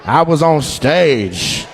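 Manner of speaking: exaggerated slow retelling; slow, deliberate repeat. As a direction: drag each word out slowly. Repeating her answer slowly.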